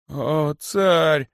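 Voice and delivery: high, plaintive hiss. A narrator's reading. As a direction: whine it pitifully. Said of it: "жалобно прошипел косой". (жалобно прошипел)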